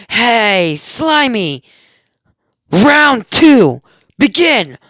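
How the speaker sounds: high, loud taunting shout; challenging yell. Acted. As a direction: yell a battle challenge at the villain. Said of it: "yells out to the sludge villain".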